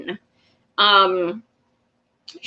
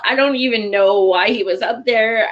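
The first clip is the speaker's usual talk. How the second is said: ordinary delivery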